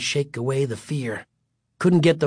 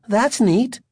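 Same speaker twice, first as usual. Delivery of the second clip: high, pleased exclamation; exclaiming; 9 semitones higher than usual. A narrator's reading. Mother admiring the new car, exclaiming. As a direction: exclaim it brightly, pitched up. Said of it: "Greg's mother exclaimed".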